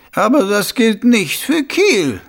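a scheming noble, savouring his idea; sly, high-pitched lordly voice; scheming Lord Schnappüber. Acted.

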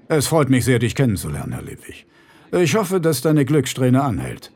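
a powerful man's cold politeness; bear-like rich man. Acted.

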